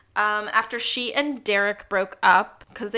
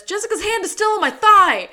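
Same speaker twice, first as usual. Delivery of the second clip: much higher and louder, rattled; panicked yell; 10 semitones higher, 10 dB louder than usual. Retold or imitated. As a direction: blurt it out in a frightened shout. This pandemonium.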